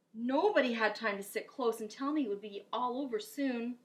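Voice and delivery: plain reading voice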